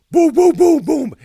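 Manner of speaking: high, booming shouted knocks; vocal banging sound effect; loud door-pounding imitation. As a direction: hammer out each knock at full voice.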